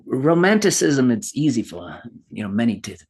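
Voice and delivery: put-on a light British accent, musing. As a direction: answer like a refined Brit on romance. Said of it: "answer the next question with your best British accent".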